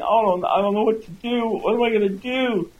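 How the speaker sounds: high-pitched, hammed-up despair; acted sobbing distress. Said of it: "and they're sobbing".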